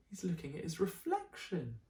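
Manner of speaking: plain reading voice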